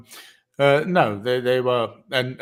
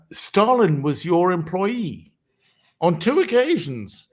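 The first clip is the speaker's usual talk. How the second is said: quoting him; 7 semitones higher, 4 dB louder than usual. Retold or imitated. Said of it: "and he said".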